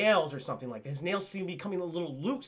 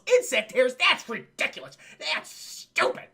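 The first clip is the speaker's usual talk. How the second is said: raised pitch, outraged disbelief; scoffing, last word stretched out; incredulous scorn; 5 semitones higher, 4 dB louder, slower than usual. Retold or imitated. Man rejecting news of insect hairs, acted indignant disbelief.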